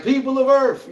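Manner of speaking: booming high alien herald voice; alien announcement. Retold or imitated. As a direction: proclaim it like an alien invader. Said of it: "She pulling up like".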